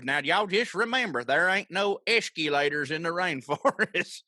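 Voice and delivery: imitated Southern Oklahoma twang, dragging the words; Joe Exotic. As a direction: stretch it out in a thick country twang. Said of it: "Joe definitely has twang. What did he say?".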